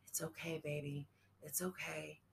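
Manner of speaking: tender comfort to her son; soft reassurance. A narrator's reading. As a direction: soothe him as she fades. Dying mother comforting son.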